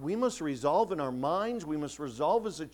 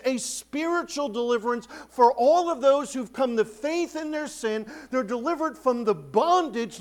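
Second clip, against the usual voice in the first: high, loud pulpit voice; fervent preaching; 7 semitones higher, 7 dB louder than usual. Acted. Pastor picturing the Passover bread, fervent preaching. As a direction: preach the deliverance with fire.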